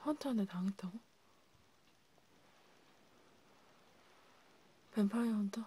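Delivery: hushed, breathy whispered questions. Acted. Vampire healer asking the wounded patient, in a whisper. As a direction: whisper it like a secret between vampires.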